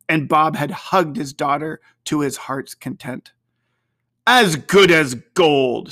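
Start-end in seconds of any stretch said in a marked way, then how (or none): 4.2-5.9 louder, slow, proud father's reply; fond father. A narrator's reading. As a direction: Bob praising his son tenderly. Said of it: "said Bob".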